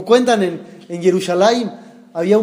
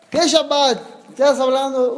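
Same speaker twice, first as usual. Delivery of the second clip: higher-pitched indignant challenge; mock outrage, raised in pitch only; retold yelling; 5 semitones higher than usual. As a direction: fire back as the incredulous landlord. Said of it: "le empezó a gritar peor".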